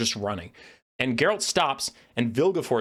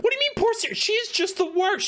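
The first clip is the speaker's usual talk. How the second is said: incredulous outburst. Retold or imitated. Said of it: "I'm like".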